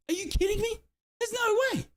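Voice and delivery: high-pitched, stunned disbelief; incredulous. Real, not acted. Streamer reacting to Plymouth's fourth, incredulous. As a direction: climb into a high squeal of disbelief.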